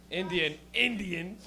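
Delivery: ordinary talk, nothing marked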